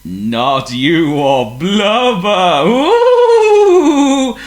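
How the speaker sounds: slow, loud, booming ghostly voice; eerie ghost voice. A narrator's reading. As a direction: boom like a spooky conscience. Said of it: "said the ghostly voice again".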